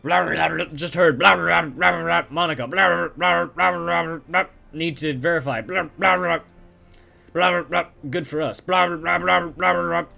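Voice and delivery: high, loud blah-blah chatter through machine noise; muffled overheard voices. Acted. Eavesdropping on muffled voices, high, loud blah-blah chatter. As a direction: babble the words like voices half heard through a wall. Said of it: "A nasal woman who sounds like a heavy smoker, and a man who speaks in a high-pitched".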